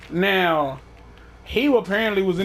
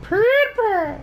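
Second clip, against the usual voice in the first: mock announcer; slower than usual. Announcer-style name drop.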